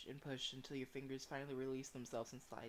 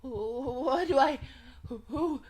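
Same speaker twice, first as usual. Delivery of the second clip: high, loud, drawn-out panicked stammer; overwhelmed and near tears; panicked, tearful; 9 semitones higher, 14 dB louder, much slower than usual. Acted. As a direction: wail it helplessly, gasping between words. Acting character's panic attack.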